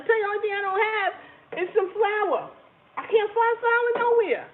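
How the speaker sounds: high, fast outburst about the missing flour; exasperated complaint. Real, not acted. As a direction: vent it, pitch way up. Can't find flour anywhere.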